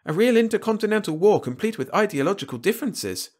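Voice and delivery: high-pitched, boastful alien voice; proud Malan. A narrator's reading. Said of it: "the man stated proudly".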